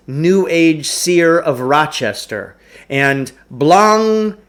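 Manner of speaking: ordinary delivery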